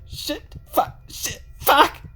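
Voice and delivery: high, breathless cursing under stress; panicked cursing. Acted. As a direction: swear in rising panic.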